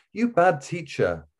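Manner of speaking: put-on high, slow scolding little girl; a little girl telling off her teacher; child scolding. A narrator's reading.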